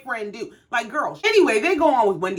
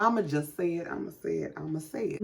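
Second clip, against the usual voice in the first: low, much softer aside; confiding aside; 4 semitones lower, 9 dB quieter, faster than usual. Real, not acted. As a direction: drop the voice to confess an opinion. Hesitant aside before a criticism.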